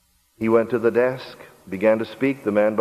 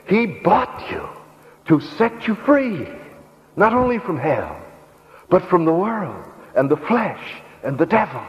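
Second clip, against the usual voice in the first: impassioned preaching cry; 7 semitones higher than usual. Real, not acted. Preacher proclaiming freedom from hell and the devil, high impassioned cry. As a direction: cry it out high, each phrase falling off.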